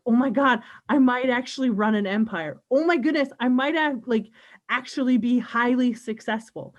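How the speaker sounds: excited disbelief. Retold or imitated. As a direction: gush like you just realized it. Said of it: "I'm like".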